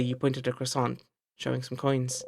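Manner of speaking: normal talking voice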